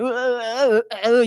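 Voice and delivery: mock an attempt to read bare consonants; stuck, wordless grunting in place of reading; vowelless reading demo. Shown as a demonstration. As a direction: run of halting "uh" grunts. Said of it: "이렇게 끝나는 거예요".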